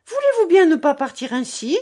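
high, loud indignant call; shouting. A narrator's reading. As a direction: shout after him to stop him leaving. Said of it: "cria-t-elle". (crier fort et aigu)

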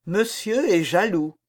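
usual speaking voice

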